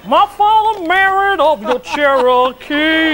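slow, loud, dramatic recital. Retold or imitated. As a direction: intone the lyric like a diva. Quoting [name]'s song lyrics.

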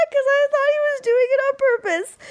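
squeaky laughing through tears; high teary laughing. Real, not acted. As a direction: still laughing helplessly. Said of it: "we've broken [name] forever".

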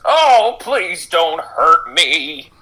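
high, very slow pleading; frightened plea. Acted. Old man begging the threatening player, high slow plea. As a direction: beg for mercy, quavering. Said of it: "He says".

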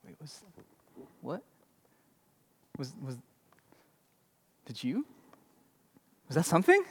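very soft, barely voiced, halting; hushed suspense. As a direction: hold your breath and whisper the doubt. Crowd straining to hear in silence.

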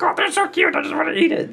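very high gushing voice over puppies; baby-talk gush. Retold or imitated. People seeing babies or puppies, high gushing coo. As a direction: coo like you see a puppy. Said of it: "they're like".

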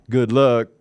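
rough go at a Southern drawl; attempted Southern accent. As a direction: put on an unpolished Southern accent. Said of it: "You gotta work on that accent".

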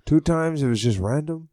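mock Mexican accent, dropping soft at the end. Host mocking the guest in a Mexican accent. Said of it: "I'm doing a Mexican accent".

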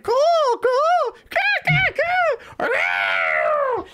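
high shrill hawk screech; hawk cry. Retold or imitated. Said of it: "you can hear 'em as they chase 'em, like".

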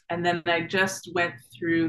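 ordinary delivery

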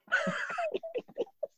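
high squeal of a laugh at the joke. Real, not acted. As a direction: burst of high-pitched laughter. Laughing at racist remark.

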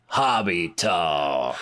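slow drawn-out announcer boom; announcer call. Acted. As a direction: call out the segment name like a show host. Show segment catchphrase call.

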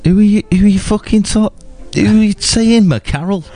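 high-pitched, indignant questioning; indignant challenge. Real, not acted. Co-host objects to mate using surname, indignant. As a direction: pull your mate up on it, voice jumping high.

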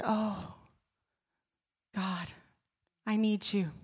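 soft, trembling prayer; hushed prayer. Retold or imitated. Woman praying to God in her car, hushed. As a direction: pray it under your breath.

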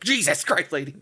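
ordinary talk

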